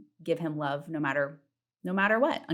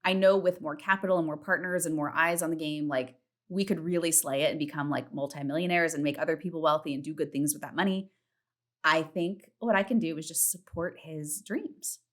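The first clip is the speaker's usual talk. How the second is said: normal talking voice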